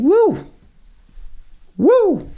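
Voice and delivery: high, joyful woo; excited whoop. Real, not acted. Developer cheering his query running, high excited whoop. Code runs, celebrating.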